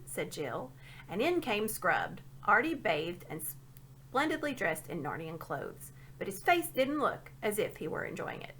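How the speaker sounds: usual speaking voice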